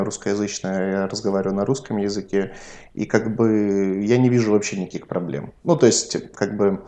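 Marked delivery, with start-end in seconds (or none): none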